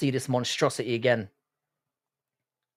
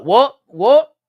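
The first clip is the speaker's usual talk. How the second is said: mock confused Scottish girl; 10 semitones higher, 11 dB louder than usual. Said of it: "you'd be like".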